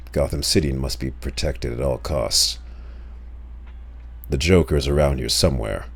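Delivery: low, gruff caped crusader growl; Batman voice. Acted. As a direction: brood it out like a dark hero. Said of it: "Batman voice is kinda like".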